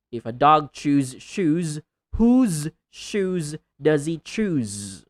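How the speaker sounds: loud, drawn-out tongue twister with buzzing Z endings; slow, emphatic word endings; clear final sounds. Shown as a demonstration. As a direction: stretch the ends of chews, shoes, whose, choose. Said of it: "clearly utter or speak the last sound".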